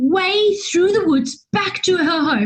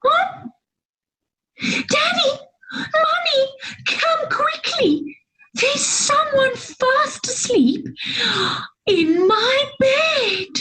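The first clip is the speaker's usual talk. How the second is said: much higher, squeaky little cub; squeaky Baby Bear; 10 semitones higher than usual. A narrator's reading. Baby Bear calls his parents, high squeak. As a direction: squeak out in alarm. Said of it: "they heard a squeak from Baby Bear".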